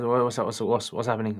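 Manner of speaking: normal talking voice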